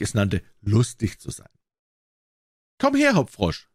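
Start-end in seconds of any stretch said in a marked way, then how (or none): 2.8-3.7 high-pitched summons, narrator acting the king; called-out command. A narrator's reading. King summons his jester into the room, called out high. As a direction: call the jester over, pitch raised high. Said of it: "rief er".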